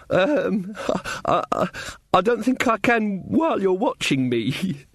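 put-on hesitant, stammering young girl; nervous stammering girl. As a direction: stumble over it nervously. Girl voiced.

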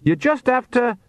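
high-pitched call, cut off mid-sentence; calling back. A narrator's reading. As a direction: call instructions back, then break off. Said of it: "she called back".

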